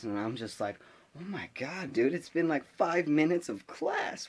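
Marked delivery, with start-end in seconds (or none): 1.2-4.3 high, stretched-out incredulous complaint; acted disbelief. Student reacting to classmate thrown out, incredulous. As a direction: voice a stunned student's exasperation.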